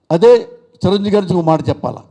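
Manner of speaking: normal talking voice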